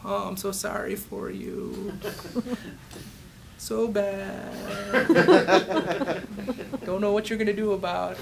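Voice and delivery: hammed-up sorry-for-you, giggling through it; mock sympathy. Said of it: "Do I go".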